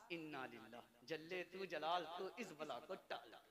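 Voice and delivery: a bride groaning at her mother-in-law, hushed; understated mock lament.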